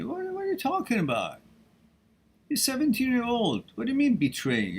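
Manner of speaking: high, disbelieving outburst; can't believe what he hears; incredulous. Real, not acted. Scolding a viewer's heartbreak.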